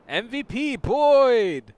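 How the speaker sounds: slow loud cheer. Real, not acted. Gamer cheering his favourite unit, loud and slow. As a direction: cheer it like a stadium crowd.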